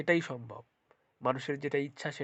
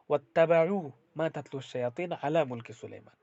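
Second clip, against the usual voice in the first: Quran recitation; 7 dB louder than usual. Acted.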